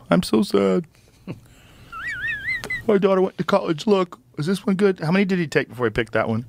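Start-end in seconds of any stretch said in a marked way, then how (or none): 0.1-5.0 higher, mock-sad voice; mock crying. Mocking his friend's crying posts on Instagram, mock sad. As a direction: imitate a man posing his tears. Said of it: "photos of him crying".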